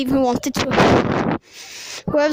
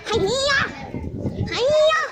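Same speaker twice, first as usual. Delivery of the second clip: very high piping greeting; squeaky hiya; 13 semitones higher than usual. Pretend. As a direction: chirp it like a cartoon.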